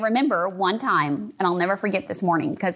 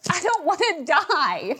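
crying plea; 9 semitones higher, slower than usual. Retold or imitated. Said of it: "cried and said".

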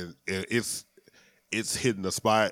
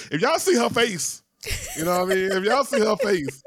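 excited; 12 semitones higher, 3 dB louder than usual. Real, not acted. Laughing, high excited.

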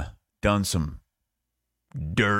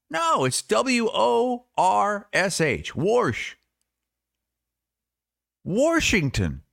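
very high, slow spelled-out correction; mock exasperation, stretched out in a high voice; imitating insistent grandpa; 13 semitones higher, much slower than usual. Grandpa defending how he says a word, mimicked high, slow and insistent. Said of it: "And he had no answer. He's like".

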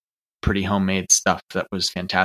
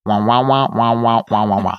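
muted-trombone wah wah louder; loud comic wah-wah gibberish; Charlie Brown adult wah; 5 dB louder than usual. Retold or imitated. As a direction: mimic the muffled trombone teacher voice. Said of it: "he was just Charlie Browning me".

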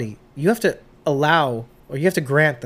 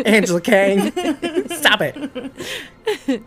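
laughing scold; 9 semitones higher, much slower than usual. Pretend. Mock scolding the showrunner.

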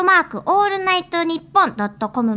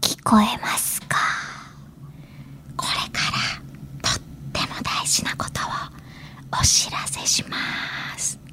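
whispering; 7 semitones lower, 6 dB quieter than usual. Shown as a demonstration. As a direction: breathe the words softly into the mic. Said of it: "番組情報をささやき声でお知らせするパターンです".

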